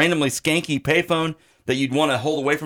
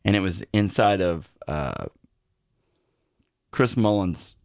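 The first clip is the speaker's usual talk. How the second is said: hushed ominous storytelling; 8 semitones lower, 3 dB quieter than usual. Acted. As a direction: draw out the cliffhanger, low and quiet.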